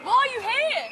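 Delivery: high furious yelling; angry shouting. Real, not acted. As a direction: scream at him to back off. Shouting at men on the Strip.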